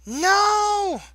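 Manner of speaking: high, loud scream of no. Acted. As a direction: scream it in horror. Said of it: "The scream came".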